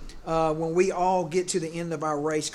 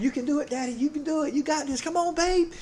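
high, excited cheering from the sidelines, much higher than his own voice; imitating his daughters cheering; 11 semitones higher than usual. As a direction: cheer him on like his girls at the finish line. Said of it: "so I just hear 'em cheering".

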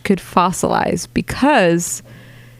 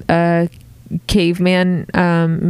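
ordinary talk

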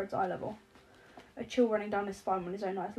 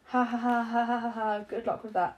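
monotone reading; 5 dB louder than usual. Acted. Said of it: "He reads out a monotone".

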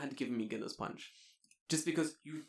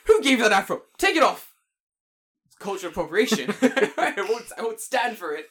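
loud, high indignant protest breaking into laughter; laughing, playful outrage; mock outrage; 7 semitones higher, 14 dB louder than usual. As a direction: shout in hammed-up indignation. Laughing mock outrage in acted scene.

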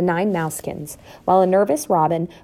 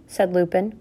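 ordinary delivery

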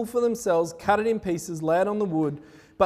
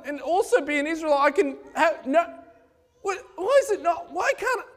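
much higher, laughing, whiny exasperation; frustrated worshipper; 9 semitones higher than usual. Acted. Baal follower complaining his god won't answer, high exasperated laughing whine. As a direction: play a baffled believer half-laughing in disbelief.

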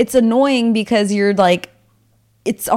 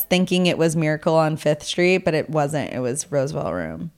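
ordinary delivery

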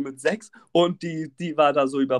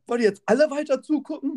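high-pitched irritated appeal; annoyed; 10 semitones higher than usual. Retold or imitated. Overwhelmed helper to staring passengers, annoyed. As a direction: challenge the onlookers in exasperation. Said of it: "so richtig genervt".

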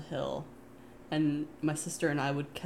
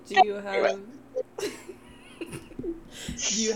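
laughing; 3 dB louder than usual. Real, not acted. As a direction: voice cracking into laughter. Laughing mid-question.

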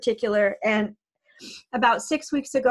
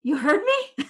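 surprised horse; 5 semitones higher than usual. Retold or imitated. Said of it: "and she went".

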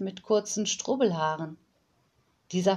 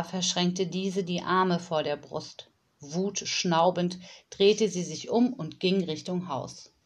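ordinary delivery

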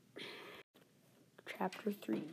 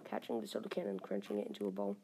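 ordinary delivery, nothing marked

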